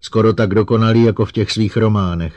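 rising, wounded tirade; almost tearful outburst. A narrator's reading. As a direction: spill it out, hurt and accusing. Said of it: "vydrmolila ze sebe Susan Calvinová téměř plačtivě".

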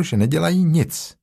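plain reading voice

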